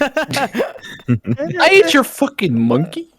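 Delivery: slow, high squeaky cartoon voice; silly high voice. Absurd high slow outburst.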